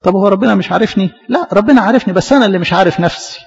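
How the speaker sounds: ordinary delivery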